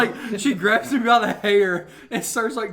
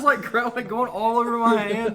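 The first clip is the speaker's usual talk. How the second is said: usual speaking voice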